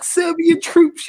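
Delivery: high-pitched mock outburst; losing it, imitated. Retelling a football streamer's reaction, mock outburst. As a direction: imitate a fan losing it, voice shooting up. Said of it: "he lost it".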